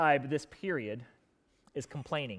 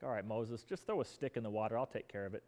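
much lower, softer, unbothered instructions; calm God voice; 8 semitones lower, 12 dB quieter than usual. Retold or imitated. God calmly telling Moses to throw a stick. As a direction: speak as a calm God, low and quiet. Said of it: "God's like".

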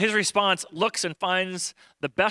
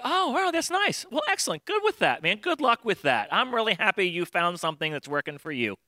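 fake polite brush-off; 3 semitones higher than usual. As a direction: gush over it with fake sweetness.

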